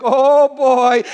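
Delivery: loud, high, drawn-out dismay; comic dread. Retold or imitated. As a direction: wail it in mock panic. Acted dread as doctor is fetched.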